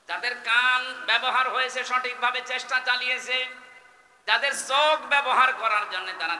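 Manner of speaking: high, loud rallying delivery; full-force preaching. Real, not acted. Preacher praises those who tried, high forceful preaching. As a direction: push each clause out over the crowd.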